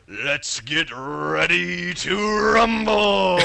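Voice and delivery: loud, high, long drawn-out fight-night call; mock arena holler, building to a big held finish; ring announcer shout. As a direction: imitate a boxing announcer, dragging out the last word. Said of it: "did he also do the".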